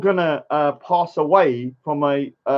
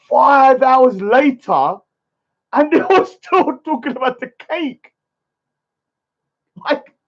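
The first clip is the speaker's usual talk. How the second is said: incredulous; 8 semitones higher, 3 dB louder than usual. Real, not acted. Disbelief at long cake argument.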